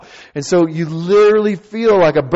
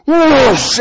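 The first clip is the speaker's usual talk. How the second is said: loud, high whoosh of wind; imitated wind rush; 11 semitones higher, 7 dB louder than usual. Storyteller mimicking the plane door opening, loud wind whoosh. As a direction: burst out with a roaring whoosh. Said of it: "interrupted by the wind and the noise".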